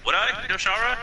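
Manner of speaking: high, lilting mock surprise; theatrical questioning, pitch leaping up; falsely modest hero. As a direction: play coy about being in charge.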